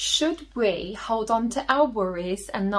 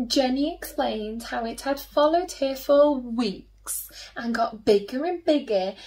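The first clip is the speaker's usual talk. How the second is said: ordinary delivery, nothing marked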